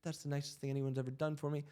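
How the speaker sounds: a mild imitation of a clerk moved to tears; retold tearful thanks. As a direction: voice her thanks as if close to tears, but understated. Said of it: "she literally burst into tears".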